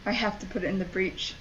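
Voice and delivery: soft, quick, under the breath; subdued realisation. Real, not acted. Player working out the task, subdued realisation. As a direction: say it low to yourself. First take, resigned realisation.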